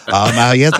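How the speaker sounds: usual speaking voice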